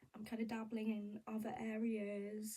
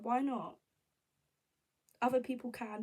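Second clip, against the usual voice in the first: louder, indignant why not; indignant outburst; 2 semitones higher, 8 dB louder than usual. Real, not acted. Vlogger firing back at doubters, loud and indignant. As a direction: push back hard, offended.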